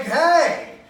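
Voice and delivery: loud, high-pitched "hey" of protest; acted-out cry of jealous objection; indignant shout. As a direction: call out in wounded outrage. Jealous protest as rival kisses her.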